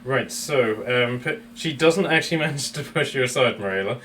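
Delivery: ordinary talk, nothing marked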